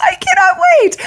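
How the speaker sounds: squealed way up high; bursting with fan glee; excited. Real, not acted. Reader gushing to viewers about the convention, squealing excitedly.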